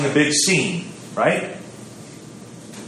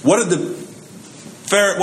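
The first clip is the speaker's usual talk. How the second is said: ordinary delivery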